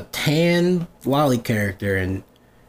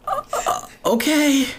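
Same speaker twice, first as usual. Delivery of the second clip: high, hesitant stammered agreement; uneasy; 13 semitones higher, slower than usual. Retold or imitated. Retelling a reply to a buyer who wants that, uneasy. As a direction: squeak out a reluctant okay.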